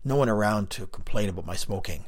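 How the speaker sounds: plain reading voice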